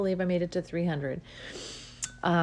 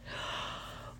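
excited gasp; 12 dB quieter than usual. Real, not acted. Excited by own idea.

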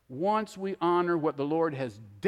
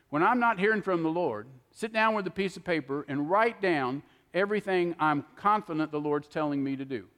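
usual speaking voice

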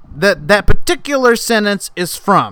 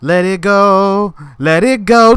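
usual speaking voice

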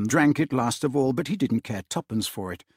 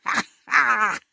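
high hearty group laughter; lusty roaring laugh; 9 semitones higher than usual. A narrator's reading. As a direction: roar with laughter. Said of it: "roared out lustily".

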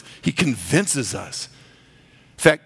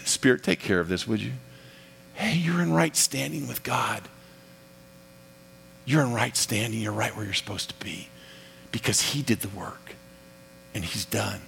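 soft, low, soothing aside; tender acted comfort, hushed; gentle reassurance; 5 semitones lower, 7 dB quieter than usual. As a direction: reassure them softly, voice dropping away. Voices Jesus reassuring the discouraged believer.

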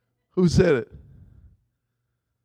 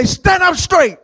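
higher, louder order; firm command; 10 semitones higher, 4 dB louder than usual. Retold or imitated. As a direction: bark it like a drill sergeant. Command to sore-backed wife.

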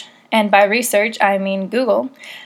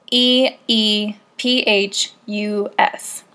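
slow spelling; 4 dB quieter, much slower than usual. Shown as a demonstration. Spelling the word out.

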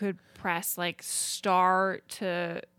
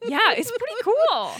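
mock excited; 12 semitones higher, 7 dB louder than usual. Real, not acted. Sarcastic enthusiasm.

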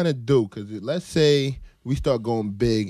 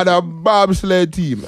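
slow, higher, loud mock Jamaican punchline; mock Jamaican accent; 7 semitones higher, 9 dB louder, slower than usual. Host tacking a bobsled joke onto his mock Jamaican rant. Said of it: "this guy is gonna offend the whole world".

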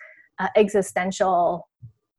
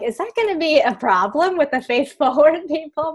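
worried self-quote; 8 semitones higher, 5 dB louder than usual. Retold or imitated. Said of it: "I was like".

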